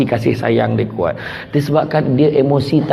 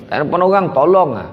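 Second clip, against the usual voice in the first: usual speaking voice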